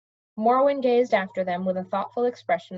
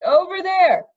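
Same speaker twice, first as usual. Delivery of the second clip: loud shout over a roaring waterfall; 9 semitones higher, 8 dB louder, slower than usual. A narrator's reading. Mendenbar shouting back to Cimmerian over the waterfall, loud yell. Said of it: "Bendebar shouted back".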